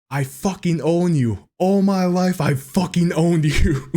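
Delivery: retold scream. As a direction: imitate a player yelling at the stands. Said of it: "he screams back into the".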